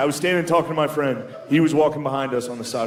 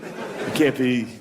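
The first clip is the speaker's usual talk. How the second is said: normal talking voice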